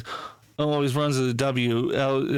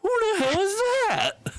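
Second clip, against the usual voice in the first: very high, drawn-out mock squeal; exaggerated bafflement. Retelling a reaction to a mangled name, comically baffled. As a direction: imitate someone wildly puzzled, way up high.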